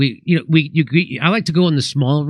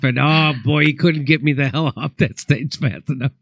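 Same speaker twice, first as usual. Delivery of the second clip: usual speaking voice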